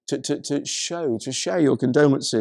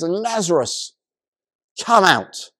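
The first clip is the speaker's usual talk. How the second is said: acted summons, louder on the last words; calling out; 3 semitones higher, 3 dB louder, slower than usual. Jesus praying then summoning the dead man, acted call. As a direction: call him out with a firm, raised voice. Retold loud summons from the tomb.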